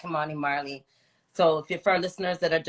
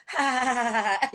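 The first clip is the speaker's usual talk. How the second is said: a quick playful "ha, ha, ha"; teasing mock laugh; 6 semitones higher than usual. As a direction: laugh it out as the lyric would. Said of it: "You say".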